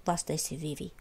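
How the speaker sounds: usual speaking voice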